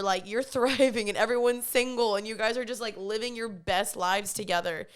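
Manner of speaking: ordinary delivery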